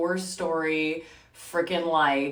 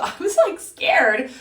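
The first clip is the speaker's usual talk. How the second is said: ordinary talk, nothing marked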